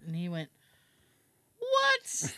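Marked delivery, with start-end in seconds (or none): 1.6-2.3 high-pitched shocked plumber; incredulous high squeak; retold startled disbelief. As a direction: imitate a plumber caught off guard, pitched right up.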